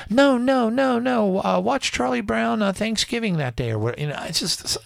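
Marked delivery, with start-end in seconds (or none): 0.1-3.7 high, dismissive mock voice; mocking troll voice. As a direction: brush it off like a smug troll. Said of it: "they want to throw you off the trail and say".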